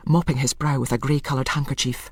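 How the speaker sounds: ordinary delivery, nothing marked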